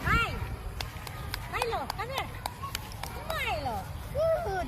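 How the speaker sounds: high-pitched call, then praise; high pet-talk voice; calling the dog. Real, not acted. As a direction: coax the dog over in a high voice.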